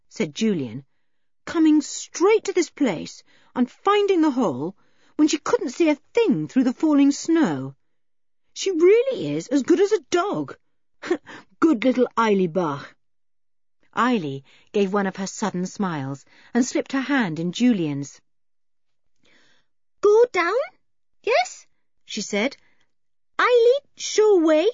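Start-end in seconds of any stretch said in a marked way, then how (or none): 1.4-12.9 put-on lifted, brighter boy's voice, admiring; boyish hero voice. Julian's quoted speech, high voice. 20.0-21.7 put-on high, loud and simple, childlike questions; a shy mountain girl asking in few words; wild girl's voice. Eileen, wild girl, broken English. 23.4-24.7 put-on high, loud and simple, childlike questions; a shy mountain girl asking in few words; wild girl's voice. Eileen offers to lead.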